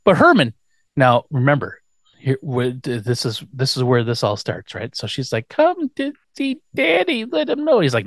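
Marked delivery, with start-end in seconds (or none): 5.5-7.8 put-on helpless baby voice; mock baby talk. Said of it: "she's baby talking".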